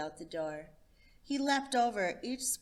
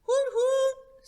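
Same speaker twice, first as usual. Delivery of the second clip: high, loud hooting call; a boy calling like a bird; 10 dB louder than usual. Acted.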